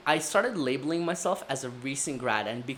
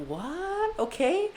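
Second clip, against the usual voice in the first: baffled what; 14 semitones higher, much slower than usual. Retold or imitated. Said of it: "I'm like".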